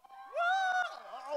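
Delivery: high, loud celebratory woo; excited whoop. Real, not acted. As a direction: cheer the champagne pop. Cheering the champagne pop.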